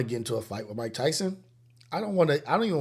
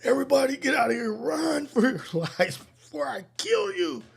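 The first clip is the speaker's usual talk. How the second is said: high, loud werewolf warning, stretching out at the end; hammed-up frantic cry to run for it; transforming werewolf; 12 semitones higher, 4 dB louder than usual. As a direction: yell like you're turning into a monster. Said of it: "he's morphing into a werewolf".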